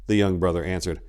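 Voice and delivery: ordinary delivery, nothing marked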